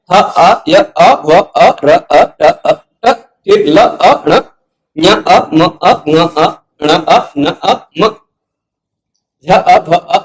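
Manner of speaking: each consonant followed by a separate अ, spelled out; letter by letter. Shown as a demonstration. Teacher spelling out the sound list letter by letter for students. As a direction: tick off every letter with its अ. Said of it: "अ जुड़ा हुआ है सब में".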